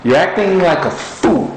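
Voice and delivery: a hint of God's sharp rebuke; stern God rebuking. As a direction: scold like a lightly voiced Almighty. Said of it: "He rebuked me sharply".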